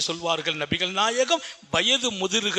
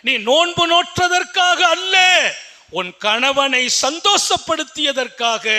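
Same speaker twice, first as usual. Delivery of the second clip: higher, raised sermon voice; fervent preaching; 6 semitones higher, 4 dB louder than usual. Acted. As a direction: proclaim it to the congregation. Preaching at full force. (உரத்த பிரசங்க குரல்)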